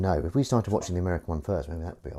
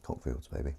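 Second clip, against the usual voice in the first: soft breathy whisper; whispered sign-off; 8 semitones lower, 17 dB quieter than usual. Real, not acted. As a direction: murmur it like a cheeky secret.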